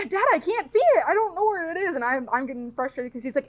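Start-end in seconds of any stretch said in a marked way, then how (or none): 0.1-1.9 higher, lightly whining; pleading kid self. Retold or imitated. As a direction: beg dad for help.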